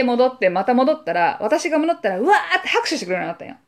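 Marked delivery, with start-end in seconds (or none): none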